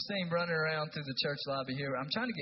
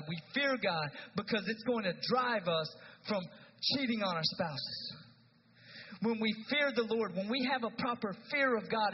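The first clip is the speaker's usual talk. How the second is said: rising preacher's fervour, higher as it climbs; building sermon; 7 semitones higher than usual. Real, not acted. Pastor warns the congregation what fear of God prevents, rising fervour. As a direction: preach it harder with every line.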